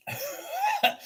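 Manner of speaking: a quick high burst of laughter; short high laugh. Real, not acted. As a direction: laugh at your own miss.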